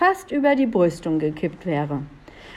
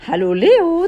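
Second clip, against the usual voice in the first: high, louder elf greeting; chirping; 9 semitones higher, 5 dB louder than usual. A narrator's reading. Elf greets Leo from above, chirping. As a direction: chirp it out in a raised voice. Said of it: "zwitscherte Rosalinde".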